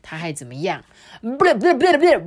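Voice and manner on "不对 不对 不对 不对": high, loud fussy pot voice; a picky pot saying no; pot refusing. Acted. Pot rejecting tomatoes, loud and fussy.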